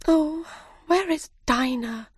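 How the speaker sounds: put-on high, worried girl's voice; Alice asking for her cat, high and worried; anxious Alice. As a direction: ask it like a little girl missing her cat. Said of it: "said Alice".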